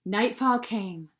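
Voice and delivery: ordinary delivery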